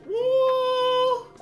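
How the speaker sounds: ordinary talk, nothing marked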